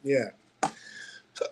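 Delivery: words broken by hiccups; hiccuping between words. Real, not acted. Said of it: "excuse me, I got the hiccups".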